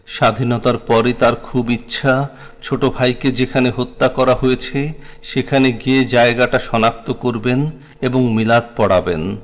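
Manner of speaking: ordinary delivery, nothing marked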